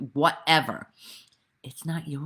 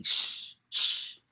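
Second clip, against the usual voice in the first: breathy hushing shh, no voice; imitating a grandmother shushing. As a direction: hush a fidgety kid in the cinema. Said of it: "his grandmother would be".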